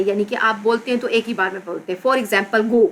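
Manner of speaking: ordinary talk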